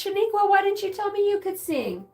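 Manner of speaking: mock amazed teacher. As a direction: gush over her singing. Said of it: "He goes".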